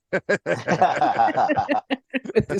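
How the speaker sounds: long real laugh; sustained rolling laughter. Real, not acted. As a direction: crack up at your own joke. Laughs at own jab.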